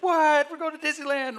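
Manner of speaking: very high, loud squeal of surprise; a child who can't believe it; amazed kid. Retold or imitated.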